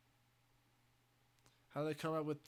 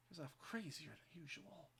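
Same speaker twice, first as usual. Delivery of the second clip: put-on Joker accent; 12 dB quieter than usual. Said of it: "Australian accent".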